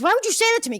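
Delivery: imitated angry outburst, high and loud; high-pitched, indignant mock challenge; angry retort. Retelling the scene where he confronts the woman, angry. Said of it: "Gets really angry".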